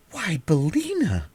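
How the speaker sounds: put-on high, slow, shocked girl's cry; little girl shocked at her hen. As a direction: gasp out her name in dismay. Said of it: "cried Dorothy in a shocked voice".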